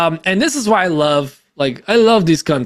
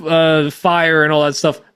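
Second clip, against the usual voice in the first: ordinary talk, nothing marked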